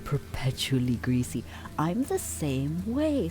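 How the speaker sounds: ordinary delivery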